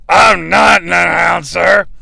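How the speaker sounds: slow, strained slur; Shawn Michaels. Acted. Wrestler insisting he's no announcer, laboured drunken slur. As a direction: roll the words together with effort. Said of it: "it sounds like it took so much effort".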